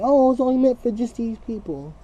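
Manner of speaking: high, prissy mock voice; mimicking a snooty gatekeeper, pitched high; mocking possessive people. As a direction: parody someone hoarding love. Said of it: "y'all bottle love up and be like".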